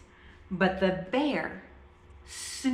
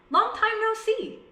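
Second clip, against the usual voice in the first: hare greeting; 12 semitones higher than usual. A narrator's reading. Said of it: "says Hare".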